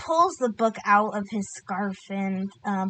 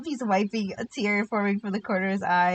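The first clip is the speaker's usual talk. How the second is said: usual speaking voice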